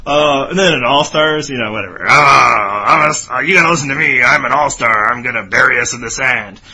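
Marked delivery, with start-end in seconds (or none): 2.1-6.6 loud, fast, bossy all-star boast; hammed-up pushy castaway; Rupert impression. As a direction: talk over everyone, insisting. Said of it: "It's the Rupert impression".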